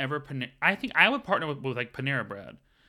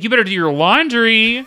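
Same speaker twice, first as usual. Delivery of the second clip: high, loud mock nag; sassy phone scolding its owner about laundry; sassy scolding assistant; 9 semitones higher, 13 dB louder than usual. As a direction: wag a finger at the owner. Sassy GPS scolds user.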